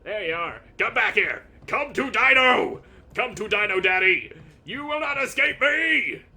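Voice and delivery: high, loud menacing roar of a hunting beast; monster roar. Pretend. The dinosaur player bellowing at the escaping cat.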